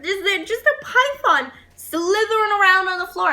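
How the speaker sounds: usual speaking voice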